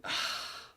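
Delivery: breathy acted sigh. Acting out the storyboard king, a sigh. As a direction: heave a soft, airy sigh. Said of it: "he's sighing".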